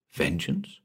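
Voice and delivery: soft, frightened whisper; fearful whisper. Acted. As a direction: breathe the word in fear. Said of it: "He whispered the word fearfully".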